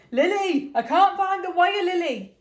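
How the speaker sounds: loud, high-pitched shout for Lily. Acted. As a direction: call out loudly through the house, pitched high.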